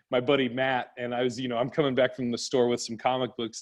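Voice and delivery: ordinary talk, nothing marked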